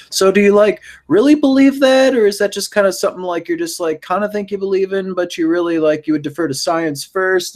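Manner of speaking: high-pitched imitation of an interviewer pressing for an answer; Jacqueline Glenn. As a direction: voice a pushy questioner, pitched well up. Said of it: "was pushing her on that stuff".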